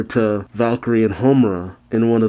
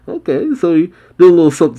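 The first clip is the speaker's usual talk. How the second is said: excited; 7 semitones higher, 6 dB louder, much faster than usual. Real, not acted. Excited reaction to the scene.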